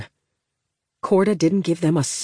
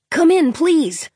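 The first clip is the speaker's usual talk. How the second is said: put-on young boy voice; 11 semitones higher, 3 dB louder, slower than usual. Boy radios a distress call.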